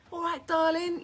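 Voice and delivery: loud, high mock workman calling out; cheeky wolf-whistle voice; builder's catcall. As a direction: shout it across the street like a builder.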